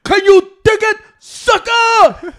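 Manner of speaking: very loud, high wrestler catchphrase bellow; Booker T catchphrase. Retold or imitated. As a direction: boom it out like a wrestling promo. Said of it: "this stupid motherfucker was in a Royal Rumble".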